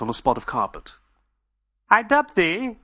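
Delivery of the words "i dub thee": high, slow regal voice; solemn royal pronouncement; Queen Victoria. Acted.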